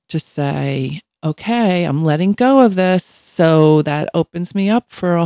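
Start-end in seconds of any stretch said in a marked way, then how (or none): 1.2-3.1 louder, mock self-declaration; acted resolve. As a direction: announce a decision to let go.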